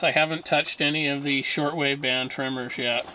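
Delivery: ordinary delivery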